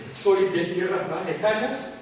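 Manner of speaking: ordinary talk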